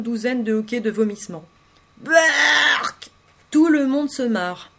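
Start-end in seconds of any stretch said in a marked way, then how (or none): 2.0-3.1 shouted, higher hammed-up yuck; overplayed disgust shout; loud mock retch. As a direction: fake a loud gagging disgust. Fake vomiting disgust.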